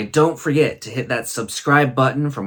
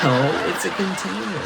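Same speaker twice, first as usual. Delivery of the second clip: higher, laughing through the correction; 8 semitones higher than usual. Real, not acted. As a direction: giggle while you correct her. Correcting her while laughing.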